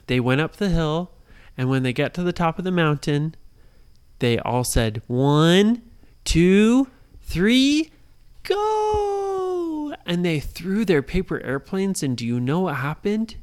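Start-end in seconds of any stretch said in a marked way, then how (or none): 5.1-9.9 rising countdown ending in a yell; countdown shout. Acted. Kids count down before throwing airplanes, building to a shout.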